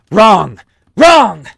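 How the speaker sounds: loud, high, frantic shouting; panicked shout. Acted.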